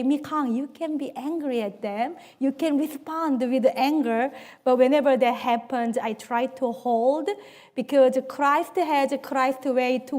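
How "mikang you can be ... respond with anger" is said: tempting whisper. Retold or imitated. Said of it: "the Satan really tempt me to say".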